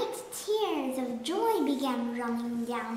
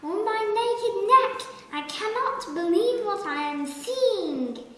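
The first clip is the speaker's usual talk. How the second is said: gasping with joy; 8 semitones higher, 6 dB louder than usual. A narrator's reading. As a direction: gasp it out, astonished and thrilled. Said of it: "gasped the giraffe".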